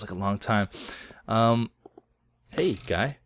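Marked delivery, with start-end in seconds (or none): none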